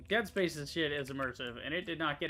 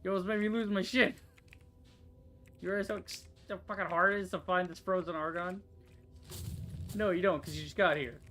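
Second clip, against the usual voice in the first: loud, higher, heated telling-off; angry scolding; 4 semitones higher, 6 dB louder than usual. Real, not acted. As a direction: scold him like he ruined everything. Scolding companion in frustration.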